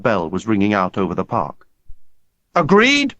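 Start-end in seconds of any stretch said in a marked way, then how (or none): 2.5-3.1 high, sharp demand. A narrator's reading. Hunter challenging the boys, high demanding call. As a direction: challenge them all to agree. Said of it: "Hunter demanded".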